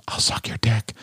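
hushed, joking beg; mock pleading whisper. Host jokingly begs a friend for beer, pleading whisper. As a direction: drop to a whisper as if begging.

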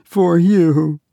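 put-on a shy girl daring to say it; Tessie murmuring. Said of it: "she murmured in a voice almost inaudible".

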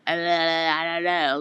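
slow, slurred drunk mumbling impression; imitating drunk friend. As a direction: drag it out like someone hammered. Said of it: "so drunk".

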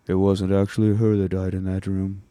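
mildly mopey mock lament; retold gloomy confession, a touch forlorn; sad sack. Host voices the brooding vampire's lament, sad sack. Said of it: "more fucking sad sack shit".

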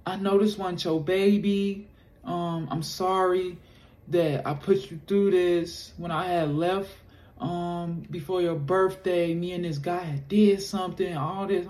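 put-on apologetic girlfriend. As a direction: play the remorseful girlfriend, slowing at the end.

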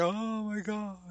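high, astonished gasp of awe; amazed client. Retold or imitated. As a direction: play the stunned client. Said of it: "Their clients are like".

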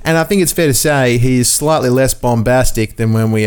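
normal talking voice

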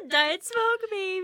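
high, laughing nickname; laughing tease. Real, not acted. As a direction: squeal the nickname while laughing. Teasing nickname through laughter.

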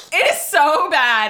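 slow, loud words each stressed; so bad, slowly and loudly; emphatic. Real, not acted. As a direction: drag it out for effect. Wrapping up the story.

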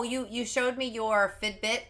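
normal talking voice

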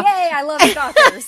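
loud, high joking cheer through laughter; mock delight. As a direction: whoop it as a playful mock celebration. Joking cheer at stalking remark.

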